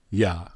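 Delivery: short "Ya." as a single syllable; syllable sound. Shown as a demonstration. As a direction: sound out "Ya." for the listeners. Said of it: "How is it pronounced?".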